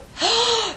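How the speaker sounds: high, loud gasp; horrified gasp. Retold or imitated. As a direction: gasp in outrage. Nun discovers damaged floor.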